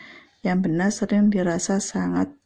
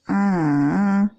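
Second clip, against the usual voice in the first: long "Ah" dipping and rising; nada mendayu-dayu; 4 dB louder than usual. Shown as a demonstration. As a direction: draw out "Ah" in a wave. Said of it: "mendayu-dayu atau bergelombang". ("Ah" bergelombang, turun lalu naik)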